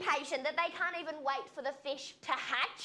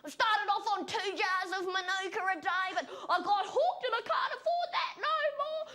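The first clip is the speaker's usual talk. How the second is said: rising higher and louder, cracking into a squeal; crazed sugar junkie; 6 semitones higher, 4 dB louder than usual. Retold or imitated. Sugar junkie craving honey, building to a manic squeal. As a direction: get more frantic with every line. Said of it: "crazed sugar junkie".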